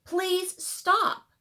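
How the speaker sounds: put-on a hurt girl begging the bully; pleading. A narrator's reading. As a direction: beg him to stop, hurt. Lucy stands up to teasing.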